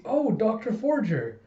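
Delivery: high, drawn-out greeting; delighted surprise. Acted. Colleague spots the doctor at work, delighted surprise. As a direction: greet him with a slow, high lilt.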